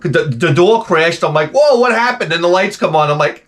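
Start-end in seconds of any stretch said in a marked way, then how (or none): 1.5-2.3 high startled yelp, jolted awake; a sleeper jolted awake, crying out in alarm; startled whoa. Retold or imitated. Woken by wife's laundry crash, startled high yelp.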